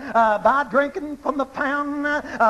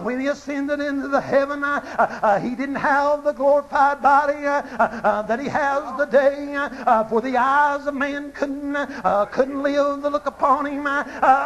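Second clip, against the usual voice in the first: ordinary talk, nothing marked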